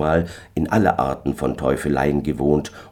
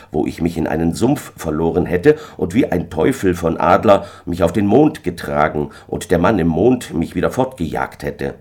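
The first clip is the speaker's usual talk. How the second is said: ordinary delivery, nothing marked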